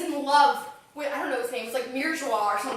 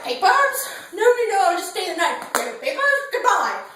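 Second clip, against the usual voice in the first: mock snooty townsfolk; 6 semitones higher, 7 dB louder than usual. Retelling Parisians refusing him.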